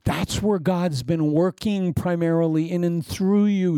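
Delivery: ordinary talk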